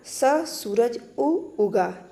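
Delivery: slow, halting "स सूरज उ उगा"; stuttering child demo. Shown as a demonstration. Teacher showing how a child stammers, slow halting "स सूरज उ उगा". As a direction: stutter on the first sounds, breaking off after "स" and "उ". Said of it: "रुक रुक कर बोलता है".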